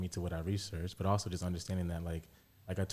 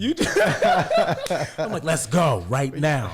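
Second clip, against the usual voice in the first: eager, laughing and loud; giddy, ready to go; excited laughing; 8 semitones higher, 14 dB louder than usual. Real, not acted. Excited reaction to exciting talk.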